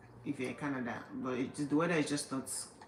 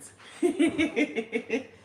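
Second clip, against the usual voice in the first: high, loud, rapid giggle; bubbling laugh, pitched up; 10 semitones higher, 6 dB louder than usual. Real, not acted. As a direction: break into a high string of giggles. Laughs at own warning.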